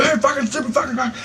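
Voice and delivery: high-pitched imitation of a driver yelling; half-mocking retelling of a driver's yell; mock angry yell. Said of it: "Fucking yelled at me".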